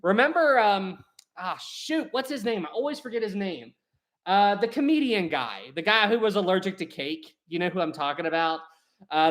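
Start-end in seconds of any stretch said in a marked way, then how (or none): none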